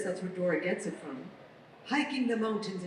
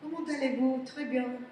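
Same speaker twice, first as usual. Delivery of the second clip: higher, lilting Parisian French; French accent; 5 semitones higher than usual. Retold or imitated. Copying the lady from Parisian style videos, French accent. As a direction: break into effortless Parisian French. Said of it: "talks with the French accent".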